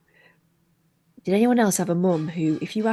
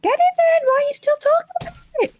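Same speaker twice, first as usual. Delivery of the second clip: very high-pitched, hurrying nag; mock exasperated scolding; 4 dB louder than usual. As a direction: squeal it in playful impatience. Mock scolding the hungry co-host.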